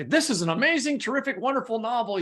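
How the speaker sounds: high, gushing praise; breathless rave; gushing blurb. Retold or imitated. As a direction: oversell the novel like a blurb. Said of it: "say great things".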